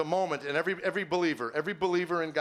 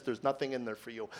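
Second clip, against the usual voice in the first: low, soft nagging inner voice; quietly discourage, like a thought; understated voice in the head; 4 semitones lower, 6 dB quieter than usual. Retold or imitated. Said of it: "another voice says".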